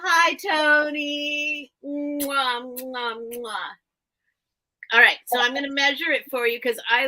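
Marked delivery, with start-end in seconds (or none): 0.0-1.7 slow, high pet greeting. Real, not acted. 1.8-3.7 smooching kisses; kiss sounds. Real, not acted. Kissing pet.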